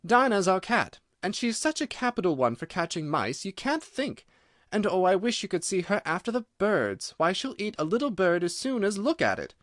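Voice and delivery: high, lively eager chatter; Alice eagerly boasting about her cat. A narrator's reading. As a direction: gush about her pet. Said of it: "Alice replied eagerly".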